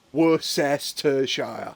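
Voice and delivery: Worcestershire slowly split into syllables; broken down. Shown as a demonstration. Teaching a friend the word syllable by syllable. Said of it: "break it down".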